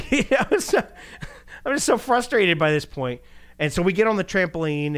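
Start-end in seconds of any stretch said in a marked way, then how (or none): 0.0-2.6 high laughing aside; laughing at his own story. Real, not acted. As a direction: laugh through the words. Laughs recalling frustration.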